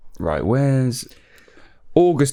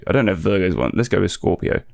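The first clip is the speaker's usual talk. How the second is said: ordinary talk, nothing marked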